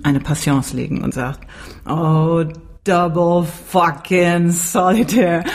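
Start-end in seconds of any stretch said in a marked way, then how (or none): 1.9-5.4 langsam und gedehnt auf Englisch; Tom aus Deadwood. Retold or imitated. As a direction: genervter Saloonbesitzer, leicht gespielt. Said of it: "Und Tom sieht ... und sagt". (gedehnter, genervter Westernton)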